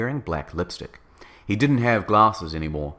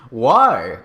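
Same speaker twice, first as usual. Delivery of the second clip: loud, sudden question; exclaiming; 3 semitones higher, 8 dB louder than usual. A narrator's reading. Ebony questioning the boy's nickname, loud exclamation. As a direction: burst out with the question. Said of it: "I exclaimed".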